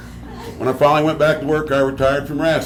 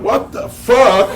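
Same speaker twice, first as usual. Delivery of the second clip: slow, higher-pitched incredulous exclamation; retold shocked disbelief; 8 semitones higher, 3 dB louder, slower than usual. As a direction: draw it out in stunned disbelief. His shocked reaction, retold.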